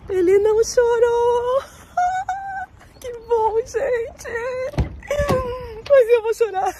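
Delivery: overwhelmed, laughing through tears; mother relieved her son didn't cry, tearful and high; crying with emotion. Real, not acted. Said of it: "Agora eu tô chorando de emoção".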